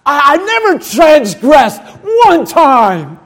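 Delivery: hammed-up proud son shouting at father; boastful elder brother. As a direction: proclaim your own goodness loudly and slowly. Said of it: "Isn't that good?".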